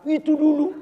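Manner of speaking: high loud squeal of "itu dulu", rising on dulu; shrill mock nag, "itu dulu"; mock nagging wife. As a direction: mimic a nagging wife dredging up the past. Mocking wife's nagging refrain.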